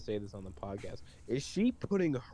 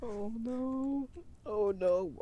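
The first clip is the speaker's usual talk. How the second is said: shocked oh no; 9 semitones higher, much slower than usual. Real, not acted. Reacts to offensive joke.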